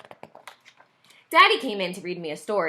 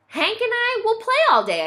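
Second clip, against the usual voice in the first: put-on high, bright kid's voice; a little boy excited about playing; eager child; 14 semitones higher, 4 dB louder than usual. A narrator's reading. Child's happy plan.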